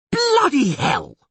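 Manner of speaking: high, drawn-out furious yell; loud yell. A narrator's reading. Dandelion yells after the line snaps, loud and furious. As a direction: let it ring out in outrage. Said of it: "Dandelion yelled so loud".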